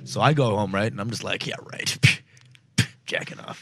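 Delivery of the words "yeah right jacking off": scoffing, half-whispered disbelief; unvoiced scoff, under the breath; sarcastic mutter. Sarcastic inner voice, retold.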